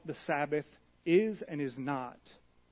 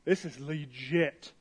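casual slang; 2 semitones lower, slower than usual. Retold or imitated. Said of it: "That's a different way to say it".

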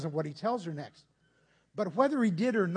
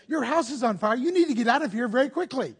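louder, fast urgent call; urgent warning; 4 semitones higher, 7 dB louder than usual. Pretend. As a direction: warn the neighbor, hurry them out. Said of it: "You would say".

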